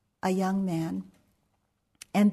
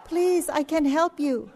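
high, loud pleading broken by laughter; laughing plea; 9 semitones higher, 5 dB louder than usual. Retold or imitated. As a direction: laugh through a mock plea. Retold pleading while laughing.

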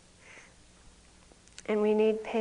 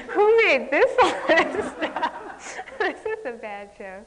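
higher, slow, laughing mock outrage; laughing disbelief; 8 semitones higher, 8 dB louder than usual. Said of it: "and I'd say".

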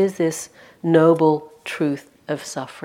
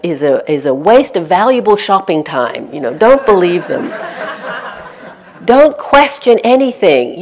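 loud, higher mock propaganda with laughter breaking through; blaring mock announcer ordering the crowd to shop; Big Brother megaphone voice; 3 semitones higher, 7 dB louder than usual. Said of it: "being the voice of Big Brother".

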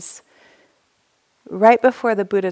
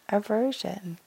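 Aversion dropped to a soft echo; soft repeat; 8 dB quieter, slower than usual. Shown as a demonstration. Teacher echoing the hindrance to the group, softly. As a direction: let Aversion fall away quietly.

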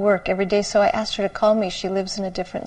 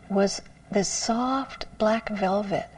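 ordinary talk

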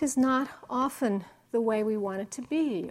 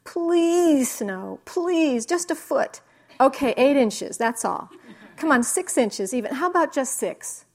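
put-on higher, louder childish begging; pleading Calvin; 3 semitones higher, 6 dB louder than usual. Calvin praying hard for snow, begging loudly. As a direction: bargain with the sky like a desperate kid. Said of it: "he squeezes as hard as he can and he says".

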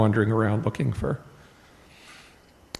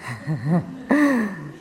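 higher-pitched laugh, tailing downward; amused laugh; 8 semitones higher than usual. Real, not acted. Reader reacting to the devas answer, amused laugh. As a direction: laugh at what you just read.

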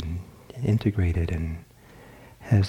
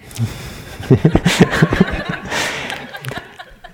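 high, bursting laugh; real laugh; 8 semitones higher, 5 dB louder than usual. Real, not acted. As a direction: let a real laugh break out. Laughs at own point.

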